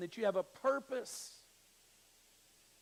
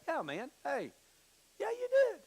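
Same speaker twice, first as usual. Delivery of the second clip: put-on cocky kid retort; 7 semitones higher than usual. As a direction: gloat like a brave kid. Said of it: "I'm like".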